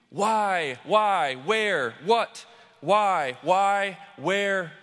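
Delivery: loud, insistent questions; the crowd pelts Moses with questions, loud and desperate; rising desperation. Acted. People hurling questions at Moses.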